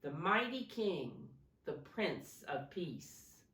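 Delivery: ordinary delivery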